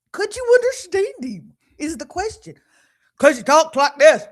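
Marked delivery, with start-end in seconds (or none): 3.2-4.2 booming, much louder twang; loud country-boy impression. Shown as a demonstration. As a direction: belt it out like the loud son. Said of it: "He talks loud".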